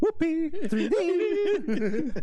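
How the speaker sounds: squealing high through laughter; laughing. Real, not acted. Friends cracking up over argument.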